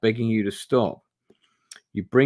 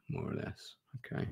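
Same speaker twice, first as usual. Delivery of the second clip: whispered aside; 17 dB quieter than usual. Real, not acted. Streamer checks the map, whispering to himself. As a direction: whisper it to yourself.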